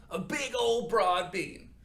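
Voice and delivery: playful drawn-out broad bean; wide stretched words. Shown as a demonstration. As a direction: draw it out big and wide. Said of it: "make yourself as wide as you can".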